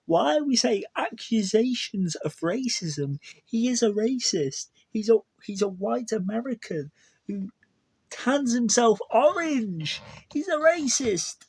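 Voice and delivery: getting too heated, pitch climbing; annoyed rant. Real, not acted. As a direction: vent real annoyance, climbing high. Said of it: "I'm getting annoyed now".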